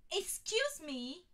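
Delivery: loud, slow, high bellow; Ginny bellowing at the distracted witch. A narrator's reading. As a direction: bark it to get attention.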